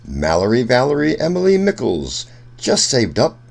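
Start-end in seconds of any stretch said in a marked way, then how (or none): none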